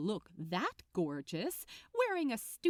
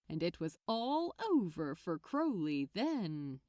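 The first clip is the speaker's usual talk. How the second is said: plain reading voice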